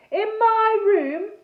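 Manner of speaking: slow, loud, high insistence; booming interruption. A narrator's reading. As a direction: insist slowly and loudly on your claim. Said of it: "he interrupted".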